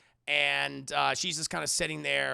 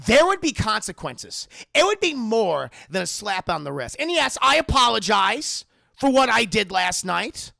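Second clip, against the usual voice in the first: loud, acted confrontation; threatening son; 5 semitones higher, 10 dB louder than usual. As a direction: lay down a threat with rising volume. Son's acted threat to father.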